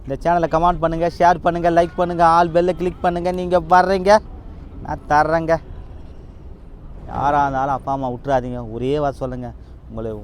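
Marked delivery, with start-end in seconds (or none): 0.0-5.6 higher, louder subscribe pitch; channel promo. Acted. Vlogger asking viewers to like, share and click the bell, promo voice. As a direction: rattle off the call to action with punch.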